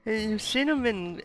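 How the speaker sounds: normal talking voice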